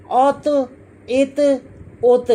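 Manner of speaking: "औत, इत, उत" with a thick t and strong rebound; thickened "औत, इत, उत", each ending "tuh"; heavy bouncing taw. Shown as a demonstration. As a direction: bounce the emphatic t of "औत, इत, उत" hard. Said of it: "खूब हिलाकर जुम्बश देकर पढ़ेंगे".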